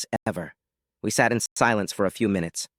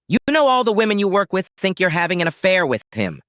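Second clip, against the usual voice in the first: higher-pitched indignant accusation; accusing; 8 semitones higher, 4 dB louder than usual. Acted. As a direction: confront her, voice pitched up. Husband confronts wife in quarrel.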